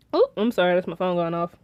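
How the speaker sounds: ordinary talk, nothing marked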